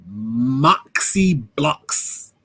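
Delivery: very drawn-out, rising stretch on the name; savouring the name. Real, not acted. Streamer reading out a viewer's name, savouring it. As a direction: roll it out slowly, relishing each part.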